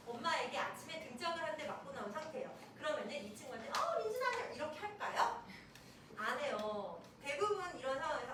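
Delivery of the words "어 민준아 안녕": much higher, chirpy acted hello; mock cheery greeting. Child greeting a friend at school, acted cheerfulness.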